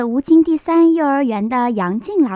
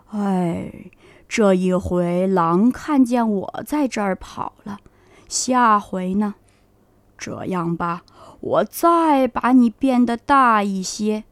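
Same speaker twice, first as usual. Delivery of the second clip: put-on lower, calm old man's voice; old grandpa; 5 semitones lower than usual. Grandpa soothes the cat after the wolf flees, low and calm.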